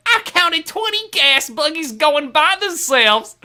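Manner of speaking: high, slow, earnest musical theatre delivery; sing-song stage actor line. Retold or imitated. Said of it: "I was like".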